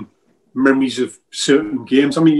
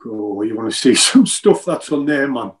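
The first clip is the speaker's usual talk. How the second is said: usual speaking voice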